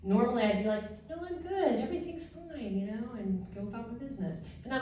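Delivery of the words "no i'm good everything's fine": high, light, everything-is-fine voice; breezy deflection. Retold or imitated. Brushing off the supervisor's concern, breezy. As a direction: wave off concern in a raised pitch. Her usual deflection retold.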